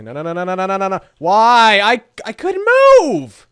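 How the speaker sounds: high, loud panicked cries; panicked yelling. Real, not acted. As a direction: yell out in rising panic. Getting downed in game.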